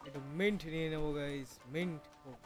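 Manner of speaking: usual speaking voice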